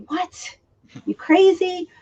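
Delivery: high-pitched disbelief, what, you crazy; retold shock, pitch jumping up; stunned, squeaky disbelief; incredulous. Disbelief at ECW coming back.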